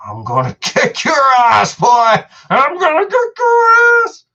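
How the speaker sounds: high, loud yowling cat voice, shouted; the cat with its ears back, spitting a shouted threat; cat's angry threat. Pretend. Voicing the cat Patsy when her ears go back, high yowling shout. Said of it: "it's like".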